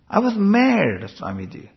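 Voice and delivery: high, drawn-out MAD, then dropping away; mock indignant protest. Devotee insisting to Swamiji they were mad, mock indignant. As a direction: act out an exasperated complainer. Acted exchange with angry Americans.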